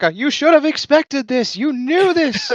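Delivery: very high, loud, comic squawk; mock accusing shout. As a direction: shriek the accusation as a parody. Mock inquisitor answering protests.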